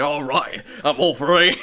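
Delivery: put-on tough guy Aussie accent; Australian accent. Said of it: "he had an Australian accent".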